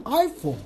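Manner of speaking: "iPhone" in American style, the "I" pitched high; American-style pronunciation. Shown as a demonstration. Host copying American pronunciation, careful model word. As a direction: hit a high "I", then drop on "Phone" in "iPhone". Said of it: "应该按照老美的那个发音".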